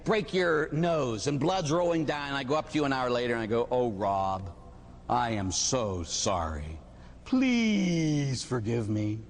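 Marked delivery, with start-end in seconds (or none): 3.7-9.2 low, drawn-out apology; put-on solemn regret; mock remorse. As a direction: apologize slowly and heavily, stretching "please". Acted apology after beating Rob.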